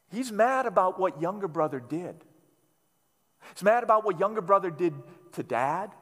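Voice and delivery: ordinary delivery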